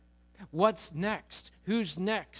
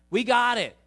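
loud, high, confident burst; overeager assurance; 7 semitones higher, 10 dB louder than usual. Acted. Disciples assure Jesus they will pray, overeager. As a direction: cut in loudly, utterly sure of yourself.

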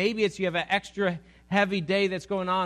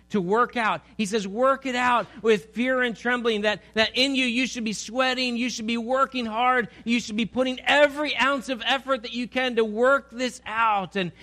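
rising, louder preacher's exhortation; preaching at full force; 5 semitones higher, 3 dB louder than usual. Acted. Preacher urging the congregation to work hard, forcefully. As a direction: drive the point home to the congregation.

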